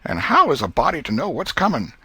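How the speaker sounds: an exasperated aunt talking herself round; Aunt Polly's voice. A narrator's reading. Aunt fretting over her nephew Tom, high and fussy.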